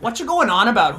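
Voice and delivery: high, loud imitation of an irate man; aggressive mimicry. Stranger confronts him outside the stall, aggressive mimicry. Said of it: "like, so aggressive".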